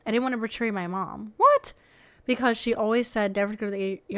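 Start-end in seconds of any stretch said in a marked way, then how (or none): 1.4-1.7 sudden, much higher and louder "What?"; shocked what. Real, not acted. Reader stunned by the poster's story, a shocked yelp. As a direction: blurt it out in shock. Reacting to absurd post detail.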